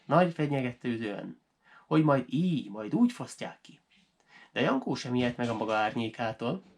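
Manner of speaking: plain reading voice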